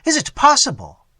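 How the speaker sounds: very high, loud disbelief; disbelieving cry. Acted. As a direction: cry it out, stunned. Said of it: "cried Theodore".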